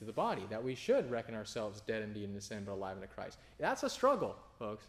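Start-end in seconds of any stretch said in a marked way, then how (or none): none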